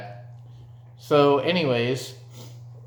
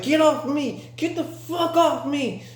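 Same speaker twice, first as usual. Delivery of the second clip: high-pitched retold yell; frantic, high mock shout; struggling protest. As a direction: act out fighting off a grab. Acted struggle against being grabbed.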